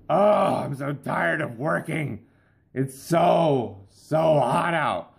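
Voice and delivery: high, whiny complaining worker; exhausted ant griping on the way to work; whiny tired ant. Pretend. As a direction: moan about the heat, dragging it out.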